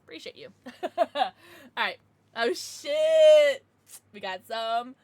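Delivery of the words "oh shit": loud burst of surprise; startled exclamation. Real, not acted. Streamer seeing a donation, blurting in surprise. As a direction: blurt it out, caught off guard.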